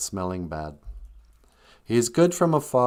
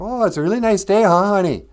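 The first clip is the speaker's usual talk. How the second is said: high, loud, quick chatter; high-pitched, oblivious wife rattling along; 11 semitones higher, 10 dB louder, faster than usual. Acted. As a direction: prattle on just to hear yourself talk. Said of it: "would just talk to hear the sound".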